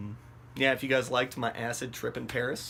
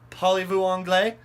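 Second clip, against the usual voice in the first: loud, high French question; hopeful calling out; 7 semitones higher, 6 dB louder than usual. Retold or imitated. Asking a stranger for help, hopeful call.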